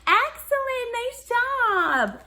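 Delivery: high, loud bright praise; delighted praise. Acted. Music teacher praising the class, high and loud. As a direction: cheer the kids on.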